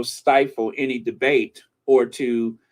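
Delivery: normal talking voice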